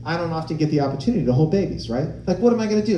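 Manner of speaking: usual speaking voice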